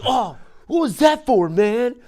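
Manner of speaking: high, loud, put-on hurt protest; mock indignant. Kid protesting after his dad slaps him, high and loud. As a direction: squeal it out like the wronged kid. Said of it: "I was like".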